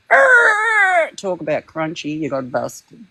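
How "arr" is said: high, loud mock pirate roar; pirate growl. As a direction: let out a hammy pirate "Arr". Growling at the maggots.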